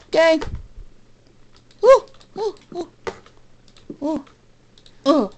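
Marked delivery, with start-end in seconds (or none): 1.8-5.3 short spaced "woo" whoops; high whoops. Real, not acted. Player signing off the episode, whooping. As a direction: let out little high whoops.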